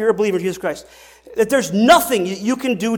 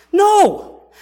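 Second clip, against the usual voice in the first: emphatic no; 10 semitones higher, 6 dB louder than usual. Real, not acted. Preacher answers his own question.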